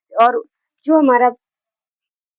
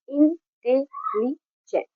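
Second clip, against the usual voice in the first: Intelligent said slowly as इन-टे-लि-जेंट, softer; split into four syllables; 6 dB quieter, much slower than usual. Shown as a demonstration. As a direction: count out intelligent in four separate beats: इन, टे, लि, gent. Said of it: "चार बार में ये cut हो रहा है".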